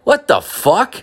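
high-pitched disbelief; acted stunned protest; baffled outrage. Acted reaction to growing rumour.

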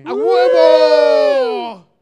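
clipping distorted mic from a huge yell; huge shout. Real, not acted. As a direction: yell so loud the mic saturates. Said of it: "Ay, qué gritote".

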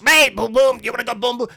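high, loud mock hawking; yelling solicitors. Everyone on the street calling to a tourist, yelled. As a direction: imitate street hustlers yelling for customers. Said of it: "everyone yells".

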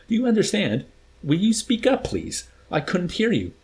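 acted officer demanding to be heard; irritated demand. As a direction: pitch it up sharply on the demand. Colonel berates his subordinates.

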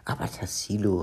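a touch of offended protest; understated, miffed reproach; mildly indignant. Acted. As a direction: object with light indignation. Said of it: "rief Olli entrüstet".

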